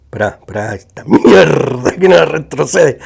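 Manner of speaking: ordinary talk, nothing marked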